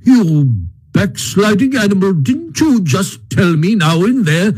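high, indignant reproach; scandalized. A narrator's reading. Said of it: "greatly scandalized".

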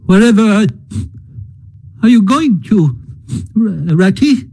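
high, halting Mole with a catch; tearful cry. Acted. Mole alarmed as Rat heads off, high tearful cry. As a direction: stumble over it, anxious and tearful. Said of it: "cried the tearful Mole".

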